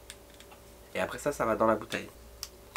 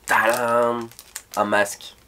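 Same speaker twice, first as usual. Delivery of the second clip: excited reveal; 2 semitones higher, 8 dB louder, much slower than usual. Real, not acted. Unveiling the next product.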